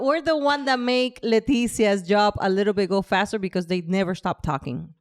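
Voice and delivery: ordinary delivery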